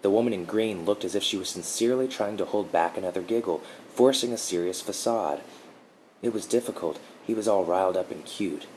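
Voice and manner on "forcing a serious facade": plain reading voice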